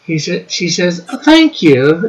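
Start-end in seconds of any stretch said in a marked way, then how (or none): 1.2-2.1 reading chat in a put-on voice, higher; hammed-up posh reading voice; a playful mock accent. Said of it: "she didn't say it like that".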